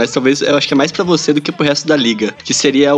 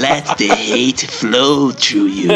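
sinister Sith voice; 4 semitones lower, much slower than usual. Pretend. Star Wars villain line quoted.

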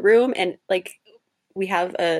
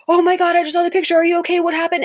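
much higher, louder, fast mock alarm; panicked ex; 11 semitones higher, 5 dB louder, faster than usual. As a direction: mimic the frantic ex-boyfriend, high and rushed. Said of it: "He's like".

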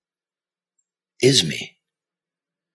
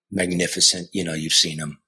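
ordinary talk

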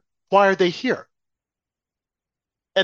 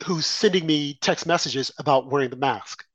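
ordinary talk

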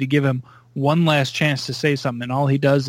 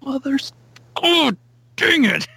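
much higher pitched cartoonish exasperation; mock frustrated villain; 11 semitones higher than usual. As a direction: play a thwarted villain cursing his luck. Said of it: "He's like".

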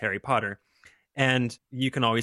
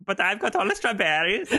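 higher, hammed-up Swede voice; mock Swedish accent; 8 semitones higher than usual. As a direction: play the strawberry Swede. Keeps the Swede bit going.

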